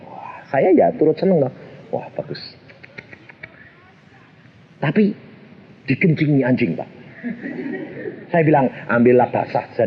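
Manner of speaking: usual speaking voice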